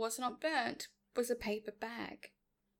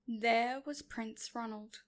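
ordinary delivery, nothing marked

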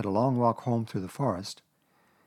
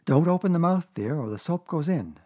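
raised brisk mother voice; motherly Kanga; 7 semitones higher than usual. A narrator's reading. Kanga bathing her supposed Roo, brisk and motherly. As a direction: warn the child mid-scrub.

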